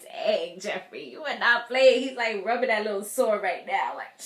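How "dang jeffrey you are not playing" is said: louder impressed. Retold or imitated. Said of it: "I was like".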